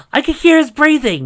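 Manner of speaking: very high and loud; high loud squeal; excited squeak. Acted. Sunny continues, loud high.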